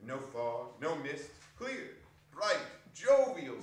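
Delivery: plain reading voice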